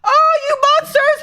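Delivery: high, crying "monsters"; tearful scolding. Real, not acted. Streamer blaming friends while crying, high tearful voice. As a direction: blame them through tears. Crying over tribute video.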